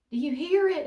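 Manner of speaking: a nervous mum's exaggerated plea to her kids; mock panicked plea. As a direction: push it up in a mock frightened wail. Mum panicking on a shaky plane.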